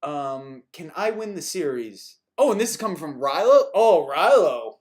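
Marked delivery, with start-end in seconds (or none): none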